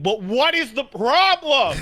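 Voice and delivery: pleading, high and loud; exasperated high shout. Retold or imitated. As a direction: yell the question in disbelief. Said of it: "And he's like".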